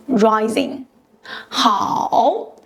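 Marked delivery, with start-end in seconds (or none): none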